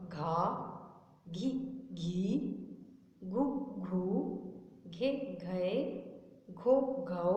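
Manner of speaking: each syllable said on its own, slow and clear, with a pause between; syllable drill. Shown as a demonstration. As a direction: recite the syllables like a class drill. Teacher recites barakhadi syllables. (अलग-अलग रुककर हर अक्षर साफ़ बोलना)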